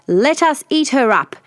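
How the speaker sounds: father bear growl. Acted. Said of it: "Father Bear growled".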